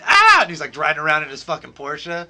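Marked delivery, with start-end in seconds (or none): 0.0-0.4 high, very loud rock scream; rock yell. Retold or imitated. Friends geeking out over the movie song, wild rock yell. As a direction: let out a wild rock howl.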